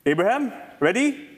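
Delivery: ordinary talk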